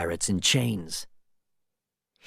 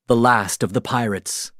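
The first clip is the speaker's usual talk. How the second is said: usual speaking voice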